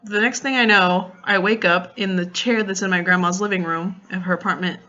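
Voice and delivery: ordinary talk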